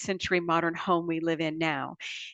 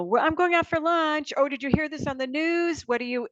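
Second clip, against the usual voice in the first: quoting family; 8 semitones higher than usual. Retold or imitated. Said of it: "you know".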